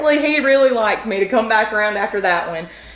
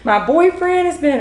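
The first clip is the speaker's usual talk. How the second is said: higher-pitched mock sobbing plea; a retold, hammed-up crying plea; imitating crying; 8 semitones higher than usual. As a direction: play the tearful student, pitched up. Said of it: "crying and she's like".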